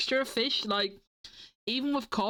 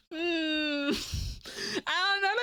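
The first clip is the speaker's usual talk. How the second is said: whiny drawl; 6 semitones higher, much slower than usual. Acted. Whiny drawn-out hum.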